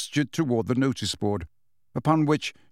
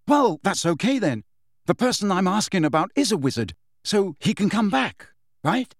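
higher, hopeful character voice; pitched-up eager reasoning; relieved boy Harry; 7 semitones higher than usual. Acted. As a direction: relief turning into a pressing question.

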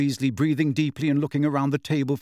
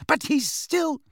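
high, agitated protest; rising voice; slower than usual. A narrator's reading. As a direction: voice climbing high as you object. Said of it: "her voice rising, her fist trembling".

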